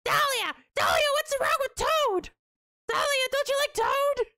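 very high squawking yell; piercing, high-pitched cartoon voice; Toad. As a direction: screech like a pleading cartoon mushroom. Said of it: "I don't want to hear Toad yelling at me".

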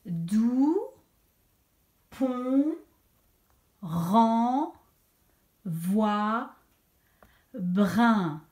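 "doux, pont, rang, voix, brin" one by one, stretched with long gaps; dictation read-back. Shown as a demonstration. Teacher repeats all syllables for checking, drawn-out "doux, pont, rang, voix, brin". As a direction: list "doux, pont, rang, voix" each rising, then let "brin" fall. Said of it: "Je les redis toutes".

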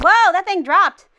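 loud, high startled exclamation. Real, not acted. Player sees a trap fall, startled cry. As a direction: blurt it out in sudden alarm. Trap suddenly drops in game.

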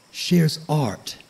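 plain reading voice

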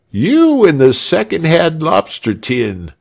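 high, loud mocking shout. A narrator's reading. A heckler shouting at Frieshardt in his armor, loud mockery. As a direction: jeer at the armored guard. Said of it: "shouted one".

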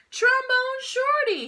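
drawn-out high call across the street. Acted. Brother calling out proudly. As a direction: call his name out loud and slow. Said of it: "he called out".

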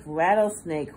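usual speaking voice

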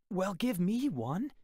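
put-on boy pleading to join, crying out. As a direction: blurt it out eagerly. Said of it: "I cried".

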